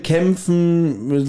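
normal talking voice

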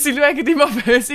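lachend wiederholt: immer böse. Pretend. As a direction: kichernd nachgeschoben. (lachend wiederholt)

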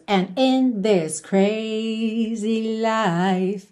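"And in this crazy life" very slow, opening words detached; separated beginning. Shown as a demonstration. Teacher models the song's chorus, drawn-out with separated beginning. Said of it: "ele dá uma separadinha no começo".

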